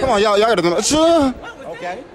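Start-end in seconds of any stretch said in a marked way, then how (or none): 0.8-1.3 loud, high fake sneeze; sneezing. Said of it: "who got the best sneeze".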